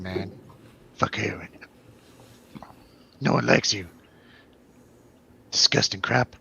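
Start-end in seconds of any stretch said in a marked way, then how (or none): none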